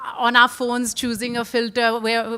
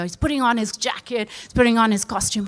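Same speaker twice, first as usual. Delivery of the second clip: ordinary talk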